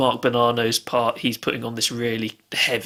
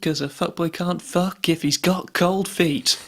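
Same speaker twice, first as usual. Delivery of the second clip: higher-pitched recital of a comic lyric; performed lyric; 6 semitones higher than usual. Retold or imitated. Podcast host quotes favourite album line to co-host, performed lyric. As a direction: play up the silly line, drawing out the end.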